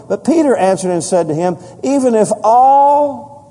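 loud, high preacher's reading of scripture; preaching scripture. Acted. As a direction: proclaim the verses to the whole church.

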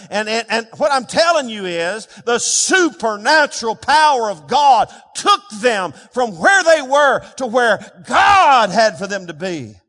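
loud shout with stretched-out key words; full-force preaching. Real, not acted. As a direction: thunder it out to the congregation.

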